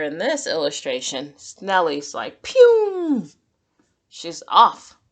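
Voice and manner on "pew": high, loud zipping "pew"; zoom sound effect. Acted. Reader making the sound of Snelly speeding off, high zip. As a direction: fire off a racing whoosh.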